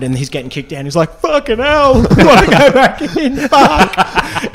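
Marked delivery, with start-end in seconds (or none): 1.2-4.5 put-on much higher drunk whine, cracking into laughter; hammed-up whiny drunk ejected; imitating heckler. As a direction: protest like a drunk being dragged out. Heckler kicked out by security.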